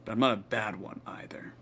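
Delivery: a sudden whisper; whispered half to himself; hushed aside. Acted. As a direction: drop to a breathy whisper. Knight insists he's not bad.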